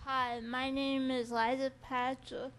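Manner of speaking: ordinary talk, nothing marked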